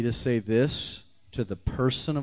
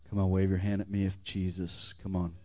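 usual speaking voice

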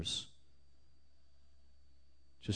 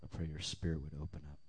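much softer, hushed prayer; 4 semitones lower, 13 dB quieter than usual. Acted. Preacher praying softly over the congregation. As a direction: pray softly over them.

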